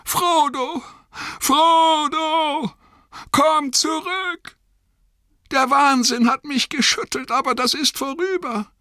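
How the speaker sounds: very high, desperate calling; verzweifeltes Rufen. A narrator's reading. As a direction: call after a lost friend, pleading. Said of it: "rief er". (verzweifelt und flehend hinterhergerufen)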